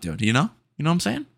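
usual speaking voice

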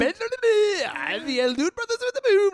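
milk every word like a wrestling intro; drawn-out hype call. Pretend. Host gives his pet intro call, slow stretched hype holler. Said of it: "Every time you do that, it gets longer".

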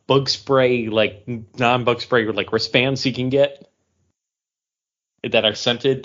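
ordinary talk, nothing marked

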